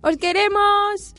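alargado, mucho más alto y fuerte; grítalo alargando cada sílaba; despedida gritada. Real, not acted. Despedida del programa a gritos. (grito cariñoso de despedida, lento y alto)

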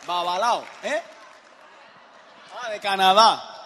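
loud, high announcing of each country to the room; booming roll call of countries; calling out to the crowd. Real, not acted.

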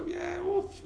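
high excited exclamation; excited husband. Acted. Husband tells his wife, high and excited. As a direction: burst out with the good news.

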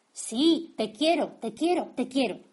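mother tossing off a hasty, loud yes; brusque loud reply. Acted. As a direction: blurt it out loud and impatient. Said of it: "Así no, mamita, así no. Dímelo con cuidadito".

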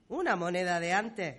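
ordinary delivery

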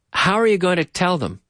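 ordinary delivery

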